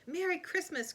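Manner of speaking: high cheerful group call; cheerful cry. A narrator's reading. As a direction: call it out together. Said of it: "cried one and all".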